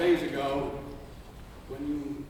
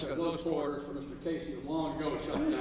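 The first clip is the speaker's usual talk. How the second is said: ordinary talk, nothing marked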